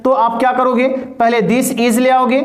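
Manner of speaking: ordinary talk, nothing marked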